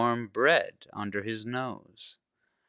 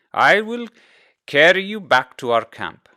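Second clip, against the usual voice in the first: firm father; 7 semitones higher, 8 dB louder than usual. A narrator's reading. Father decides firmly.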